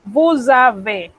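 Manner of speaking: Vous avez slowly, S becoming a buzzing Z; slow liaison. Shown as a demonstration. Teacher demonstrating liaison in vous avez, slowly. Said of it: "se convierte en Z".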